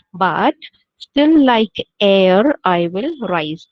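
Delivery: stretched-out defiant poetry line; poem recitation. Shown as a demonstration. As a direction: build the refrain with slow pride. Teacher recites the refrain.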